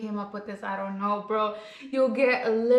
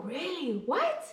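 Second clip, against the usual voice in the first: normal talking voice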